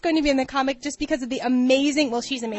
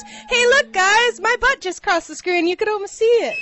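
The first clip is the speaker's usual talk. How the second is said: loud, higher mock-excited impression; Patrick Swayze; 6 semitones higher, 4 dB louder than usual. Host showing Kate the impression, hammed-up and excited. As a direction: call out gleefully, louder and pitched up. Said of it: "My Patrick Swayze impression is".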